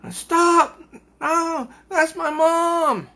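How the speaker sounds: very high, loud slow pleading yell. Pretend. Coco imagined yelling to save her mom, loud high cry. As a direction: scream it to save her mother. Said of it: "she would be yelling".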